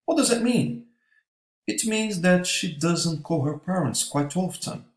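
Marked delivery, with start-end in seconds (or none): none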